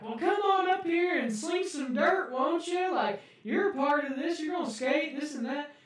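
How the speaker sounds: high, loud put-on rallying voice; hammed-up host beckoning someone up; event host imitation. Organisers inviting skaters to the groundbreaking, high mock host voice. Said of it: "And they were like".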